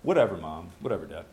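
mock dismissive brush-off, dropping low; dismissive teen. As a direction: shrug it off like a bored teenager. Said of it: "it means that you say".

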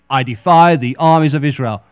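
loud, booming giant's defiance; Goliath's taunt. Acted. Preacher voicing Goliath taunting Israel's army, loud booming. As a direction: boom it across the valley like a giant.

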